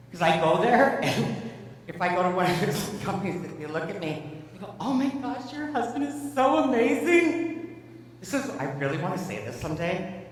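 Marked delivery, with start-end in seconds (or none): 4.8-7.8 high, swoony gush, stretched on SO AMAZING; gushing admirer. Retold or imitated. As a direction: imitate a starstruck fan. Imitating gushing employees.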